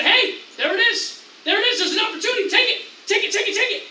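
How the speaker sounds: high, loud, rapid-fire egging on; insistent shouted goading, pitched well up; urgent urging. Acted. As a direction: push them hard to grab it now. Urging David to seize chance.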